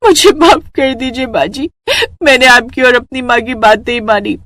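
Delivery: high, weepy confession; tearful apology. Acted. Sick bride apologising to her mother-in-law, tearful. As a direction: plead for forgiveness through tears.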